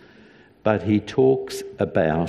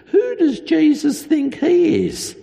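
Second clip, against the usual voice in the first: high, louder mock outrage; indignant crowd; 4 dB louder than usual. The crowd scoffing at Jesus' claim in a high mock voice. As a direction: sneer it as a scandalised onlooker.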